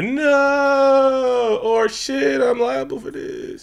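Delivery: high, slowed mock dismay; mock dismayed cry. Mocking the characters' missing grief, high and drawn out. Imagined line nobody said.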